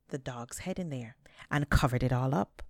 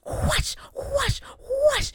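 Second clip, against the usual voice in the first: beating noises; 5 dB louder, much slower than usual. A narrator's reading. Beating the tree again.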